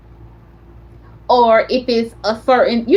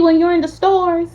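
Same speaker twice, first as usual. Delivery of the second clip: ordinary talk, nothing marked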